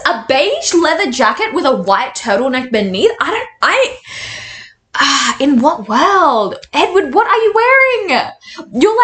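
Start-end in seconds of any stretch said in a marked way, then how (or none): 3.2-9.0 high, disbelieving cry about the outfit; shocked pitched-up outrage; incredulous squeal. Real, not acted.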